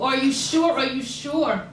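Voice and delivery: frightened mother insisting loudly; urgent anxious questioning. Retold or imitated. Mother insists again, not reassured.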